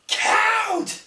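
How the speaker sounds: high-pitched imitated yell; retold yell. Wrestler demanding the referee's count, high yell. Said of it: "actually has to yell".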